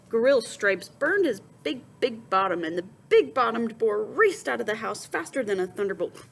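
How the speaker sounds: plain reading voice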